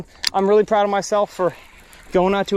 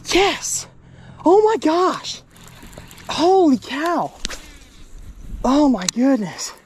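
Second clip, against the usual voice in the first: excited shout; 10 semitones higher, much slower than usual. Real, not acted. Another giant fish caught.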